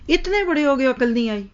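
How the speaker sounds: इतने pitched high, a sharp parental taunt; mother taunting her grown child, mock-scolding. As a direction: jab at the child like an exasperated mother. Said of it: "ये ताना देते हैं".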